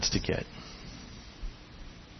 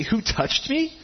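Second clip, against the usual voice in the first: high, disbelieving question; baffled disciples asking; incredulous disciples; 11 semitones higher, slower than usual. Acted.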